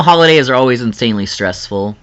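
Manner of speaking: usual speaking voice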